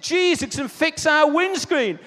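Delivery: high, loud, stretched-out jeer; imitated incredulous bellow; mocking shout. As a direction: yell it slowly as a scornful joke. Retold mocking shout among van men.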